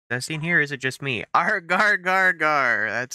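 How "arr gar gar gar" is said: playful mock growl.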